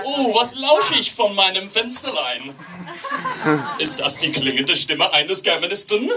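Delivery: high, theatrical German declaiming; guy at the window. Acted. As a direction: exclaim grandly in German, rising to a question. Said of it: "guy at the, the window".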